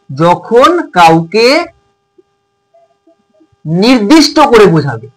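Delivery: slow, spaced words; slow dictation pace. Shown as a demonstration. Teacher slowly dictating a definition to the class. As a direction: spell it out slowly for pupils. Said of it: "slow dictation to class".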